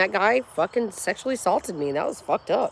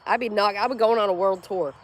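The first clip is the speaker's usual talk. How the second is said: ordinary delivery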